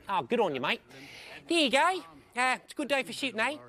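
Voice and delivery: high, loud imitation of a Kiwi accent; New Zealand accent. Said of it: "They sort of talk like this".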